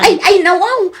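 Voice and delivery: a sudden high, loud jolt of fright; startled gasp. Acted. Startled by Nana Linda sneaking up, a high loud yelp. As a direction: caught off guard, yelp it out. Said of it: "Makapakigtot kayo mat".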